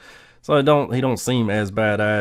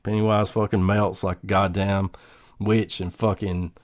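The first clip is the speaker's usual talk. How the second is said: ordinary delivery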